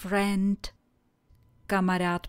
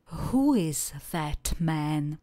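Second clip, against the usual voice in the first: slow model question; slower than usual. Shown as a demonstration. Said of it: "A vy se zeptejte".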